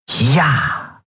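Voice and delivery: a high little cheer of victory; triumphant. A narrator's reading. Endril winning Keru over, triumphant cheer. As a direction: punch it up like a win. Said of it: "Endril triumphierte".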